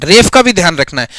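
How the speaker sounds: normal talking voice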